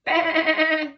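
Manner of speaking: loud, high, wobbling baa; sheep bleat. Shown as a demonstration. Teacher showing children how to baa, big sheep bleat. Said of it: "Can you do that?".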